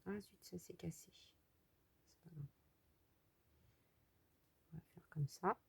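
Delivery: whispered to herself after a small mishap; murmured unvoiced, as if not to wake the sleeping puppy. Real, not acted. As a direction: hush it under your breath, no voice. Mishap while cutting, keeping quiet.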